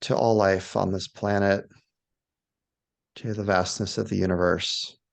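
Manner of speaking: low, spacious meditation voice with long pauses; guided meditation. Shown as a demonstration. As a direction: lead them gently outward, one phrase at a time. Leads a guided awe meditation.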